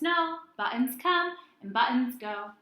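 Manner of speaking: ordinary talk, nothing marked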